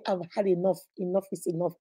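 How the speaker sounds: low, soft and done; weary final resolve. Acted. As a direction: quietly say you are finished. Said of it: "get up and say".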